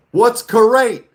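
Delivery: put-on dumb kid voice. Dumb student asking the teacher, squeaky high voice. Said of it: "this one dumbass goes".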